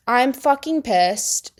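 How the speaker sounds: ordinary talk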